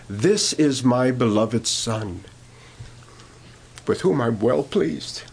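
slow, weighty reading of the holy words; slow reverent scripture read; solemn scripture reading. Retold or imitated. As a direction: let each word land slowly, reverent.

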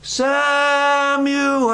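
high, long-drawn call. Acted. God calls to sleeping Samuel in the night, long high call. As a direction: stretch the name out on a high, held note. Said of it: "All the time it's".

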